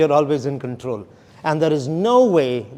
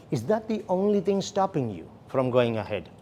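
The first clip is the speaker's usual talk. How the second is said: softer question with "only" standing out; stress on ONLY; 3 semitones lower, 7 dB quieter than usual. Shown as a demonstration. Trainer asking the client who objects on price, stress on ONLY. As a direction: put the emphasis on ONLY, falling to the end. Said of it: "the emphasis is on the word only".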